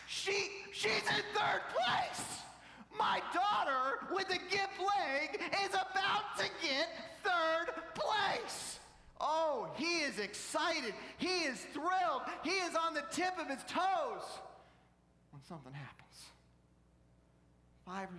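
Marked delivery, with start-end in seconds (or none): 0.1-8.8 high, drawn-out cheering yell; ecstatic shout. Retold or imitated. Dad cheering his daughter in third place, ecstatic shout. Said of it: "on the edge of his seat and then on his feet". 9.2-14.2 loud, high, stretched-out exclamations; excited preaching. A narrator's reading. Preacher telling of a thrilled dad, excited full-force preaching.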